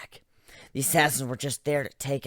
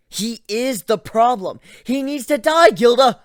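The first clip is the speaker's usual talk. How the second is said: frantic outburst; 8 semitones higher, 12 dB louder than usual. Acted. Griffin shouting to Gilda that Ivan must die, frantic. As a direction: shriek it at her, unhinged.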